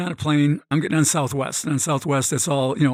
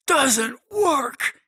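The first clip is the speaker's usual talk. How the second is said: high-pitched mock scream, much higher; Penn Jillette screaming; 11 semitones higher than usual. Magician snapping at a nosy seatmate, high mock yell. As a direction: yell it right in his face, pitched way up. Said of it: "He just screams at me".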